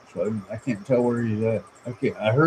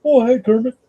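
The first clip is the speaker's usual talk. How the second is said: much higher, louder cheery muppet hello; bright puppet greeting a friend; Ernie impression; 12 semitones higher, 6 dB louder than usual. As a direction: greets Kermit in a high, bubbly muppet voice. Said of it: "have 'em talk to each other".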